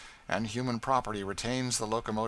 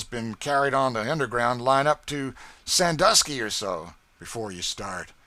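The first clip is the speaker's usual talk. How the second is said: louder, higher rough taunting; gruff scorn; 4 semitones higher, 10 dB louder than usual. Acted. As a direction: call him a coward bluntly. Marks then Tom arguing.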